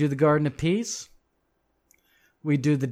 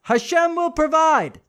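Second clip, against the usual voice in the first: high, mocking shrug of a line; higher, louder mock-pious brush-off; eye-rolling dismissal; 11 semitones higher, 4 dB louder than usual. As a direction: toss it off like a lazy believer. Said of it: "roll their eyes, shrug their shoulders, and say".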